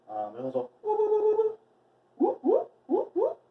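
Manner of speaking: very high, loud rising whoops to call the dog. Shown as a demonstration. Trainer demonstrates a dog call with high rising whoops. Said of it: "the bird calls and the nature calls".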